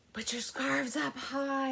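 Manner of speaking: gasped then whispered; hushed teaser line. Shown as a demonstration. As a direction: whisper it like a secret.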